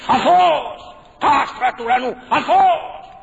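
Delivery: loud, high acted cries; imitated wife's shout. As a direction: shout a wife's warning from below. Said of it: "φώναζε η γυναίκα του". (δυνατές, ψηλές κραυγές της γυναίκας)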